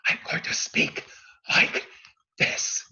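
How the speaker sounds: a projected stage whisper, no voice, driven from the diaphragm. Shown as a demonstration. Coach demonstrating a stage whisper to the class. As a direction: throw a whisper across the room. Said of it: "I'm going to speak it in a stage whisper".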